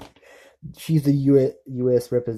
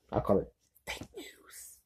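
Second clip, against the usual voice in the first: a quick hushed whisper under the breath; conspiratorial whispered aside; 11 dB quieter than usual. Real, not acted. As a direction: drop to a secret whisper for the jab. Whispered aside about the news.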